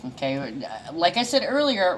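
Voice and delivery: ordinary talk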